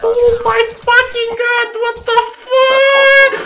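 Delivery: very high squealed disbelief; high-pitched drawn-out whine; falsetto exasperation. Real, not acted. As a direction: wail it slowly in falsetto.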